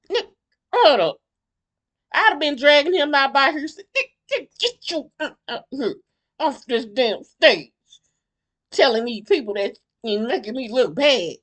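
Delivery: loud mock outrage. Host pretending to drag the man offstage, loud mock outrage. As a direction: yell like you're hauling him off the stage. Imagined dragging him off stage.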